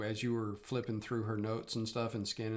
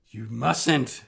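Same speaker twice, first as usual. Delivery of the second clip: alarmed; 12 dB louder than usual. Acted. Villain begs them to stop.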